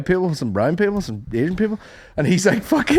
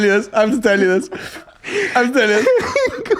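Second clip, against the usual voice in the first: can barely hold the laugh in; giggling, much higher; laughing excitedly; 7 semitones higher than usual. Real, not acted.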